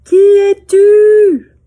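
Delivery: put-on high, slow, loud child's call; little girl calling. Girl calling out to the unseen voice. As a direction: call out into the valley like a curious child. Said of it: "Alors elle dit".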